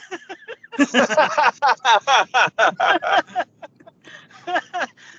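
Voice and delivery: a burst of loud laughter that rolls on; long loud laugh. Real, not acted. Telling a friend about the mix-up, long loud laugh. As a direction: laugh hard at your own story.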